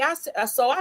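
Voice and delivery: normal talking voice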